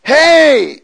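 loud, high imitated holler; retold yell. Man calling again to a stranger in the woods, imitated yell. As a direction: call out to someone far off. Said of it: "he says again".

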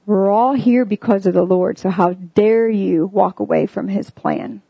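ordinary talk

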